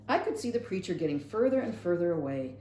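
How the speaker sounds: usual speaking voice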